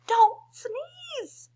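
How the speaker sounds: crying plea. Acted. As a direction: wail the plea.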